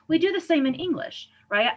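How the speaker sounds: ordinary talk, nothing marked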